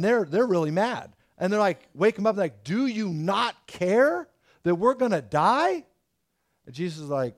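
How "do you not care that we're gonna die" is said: higher, slow, pleading panic; panicked disciples. Retold or imitated. Frightened disciples shouting at sleeping Jesus in storm, panicked. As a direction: cry out over the storm as if sinking.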